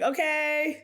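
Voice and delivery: high-pitched, uneasy okay; mock unsure okay. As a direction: agree in a small high voice, out of your depth. Retells overwhelmed reply to planning team.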